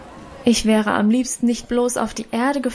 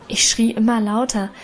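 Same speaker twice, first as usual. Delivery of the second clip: plain reading voice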